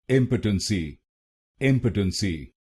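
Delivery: इम्पोटेंसी joined as IM-puh-tun-see; correct pronunciation. Shown as a demonstration. Teacher blending the drilled syllables, correctly pronounced. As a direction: run इम्पोटेंसी together with reduced middle vowels. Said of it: "तो बनेगा".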